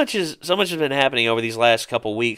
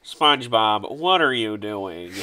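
slow, drawn-out cartoon voice; cartoon character impression; slower than usual. Fan quoting a SpongeBob scene, slow put-on cartoon voice. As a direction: stretch each word out, drooping at the end.

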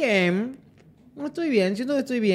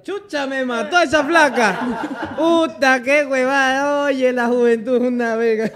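imagined angry pedestrian; 5 semitones higher, 7 dB louder than usual. Retold or imitated. Friend imagines the run-over man cursing at the gates, loud laughing mock outrage. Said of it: "Me lo imagino ese señor".

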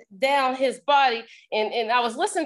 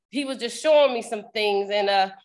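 ordinary talk

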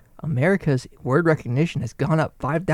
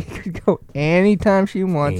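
mocking echo; 4 semitones higher, slower than usual. Mocking repeat of a boast.

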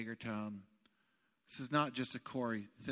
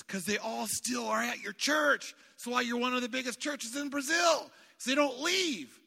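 louder, higher preaching shout; emphatic shout; 9 semitones higher, 5 dB louder than usual. Retold or imitated. Preacher quoting the Brazilian pastor, shouting. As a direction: belt it out to the congregation.

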